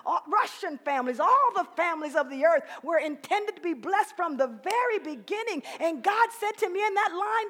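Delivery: loud, high, soaring preacher climax; full-force preaching. Real, not acted. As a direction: build it up and preach it out.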